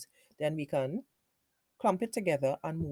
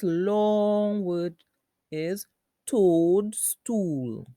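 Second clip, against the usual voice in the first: very slow, louder, with "long" stretched; emphatic slow teacher delivery; drawn out; 2 semitones higher, 5 dB louder, much slower than usual. Shown as a demonstration. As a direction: stretch both halves of "toadstool". Presenting the long blended word.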